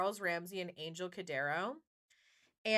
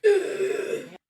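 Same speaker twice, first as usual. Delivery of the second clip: sharp loud gasp of shock; imitated shocked gasp; mock gasp; 11 semitones higher, 12 dB louder than usual. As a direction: gasp as if hearing it live. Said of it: "you just, like".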